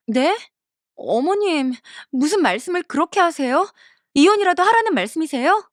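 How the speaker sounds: hurt, can't believe what she heard; shocked disbelief. Acted. Wife shocked by mother-in-law's remark.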